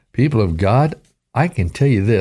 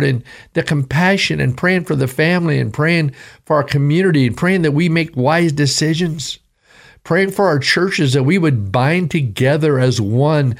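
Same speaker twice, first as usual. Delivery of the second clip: raised pitch, building preacher fervor; fervent sermon climax; preaching; 7 semitones higher than usual. Real, not acted. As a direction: lift it into impassioned preaching.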